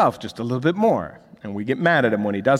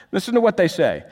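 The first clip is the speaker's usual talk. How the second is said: ordinary delivery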